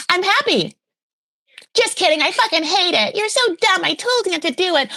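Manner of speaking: high-pitched mock-furious mother rant; Molly. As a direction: fake delight turning to shrieky scolding. Acts Molly's angry reaction.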